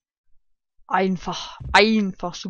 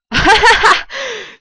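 loud incredulous laugh; loud high laugh; 13 semitones higher, 11 dB louder than usual. Real, not acted. Laughs at trick level.

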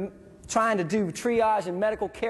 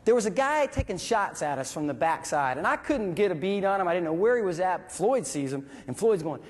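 ordinary delivery

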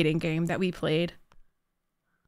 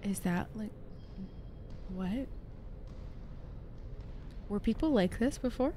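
hushed aside; 16 dB quieter than usual. Real, not acted. Streamer aside to audience.

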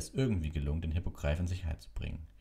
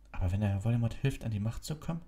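put-on worried boy; 7 semitones higher than usual. A narrator's reading. Harry voiced in story.

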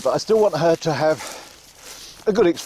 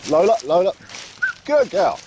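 calling the dog; 6 semitones higher than usual. Real, not acted. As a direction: call her in and praise her. Calling then praising dog.

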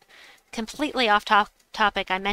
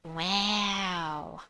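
softer, super nasally attempt; nasal wow; 6 dB quieter than usual. Streamer practicing an imitated wow, stuffed-nose nasal. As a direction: say it with something stuck in your nose. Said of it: "with something stuck in your nose".

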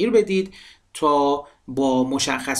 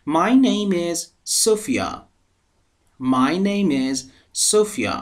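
teacher model answer; 4 semitones higher, much slower than usual. Shown as a demonstration. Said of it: "شکلای جواب دادنشو ببینید".

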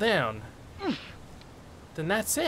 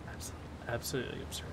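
low, hushed and fast; barely voiced grumble; muttered disbelief; 11 semitones lower, 19 dB quieter, much faster than usual. Real, not acted. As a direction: mutter it quickly under your breath. Muttering to himself at game.

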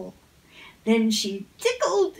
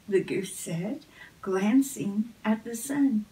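ordinary delivery

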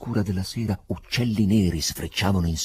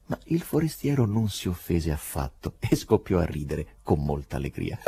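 ordinary delivery

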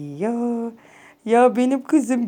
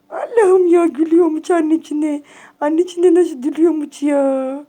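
louder, higher thrilled cooing; delighted gushing; 5 semitones higher, 5 dB louder than usual. Real, not acted. Owner thrilled her cat smiles at her, loud gush. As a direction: burst out in delight.

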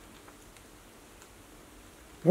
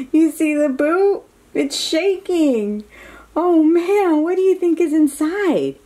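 higher, louder eager question; higher, louder, quick curious ask; excited; 7 semitones higher, 4 dB louder than usual. Real, not acted. Excited question about the boot.

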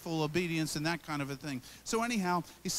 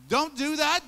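higher and louder, a sharp warning; stern command; 7 semitones higher, 7 dB louder than usual. Shown as a demonstration. Preacher voicing the verse as a command to stop, higher and louder. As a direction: stop them in their tracks. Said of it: "It can also be read".

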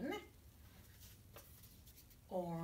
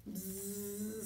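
buzzy housefly; 3 semitones lower than usual. Acted. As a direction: buzz like a little fly. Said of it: "said the fly".

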